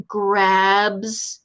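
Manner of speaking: usual speaking voice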